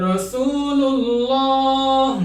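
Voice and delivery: রাসূলুল্লাহ chanted slowly with the lam heavy. Shown as a demonstration. Teacher reciting রাসূলুল্লাহ to students, slowly chanted with a heavy lam. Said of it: "মোটা করে উচ্চারণ করতে হবে".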